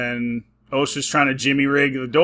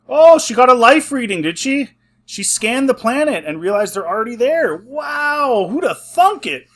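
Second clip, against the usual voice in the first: sarcastic amazement; 8 semitones higher, 4 dB louder than usual. Real, not acted. Reviewer mocks the Jedi for missing an obvious scan, sarcastic amazement. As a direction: gush sarcastically, pitched well up.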